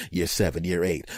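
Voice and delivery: ordinary talk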